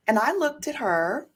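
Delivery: ordinary talk, nothing marked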